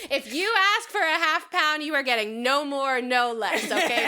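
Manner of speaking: loud, high salesman pitch for honest cuts; hammed-up butcher ad. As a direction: sell the meat like a TV ad.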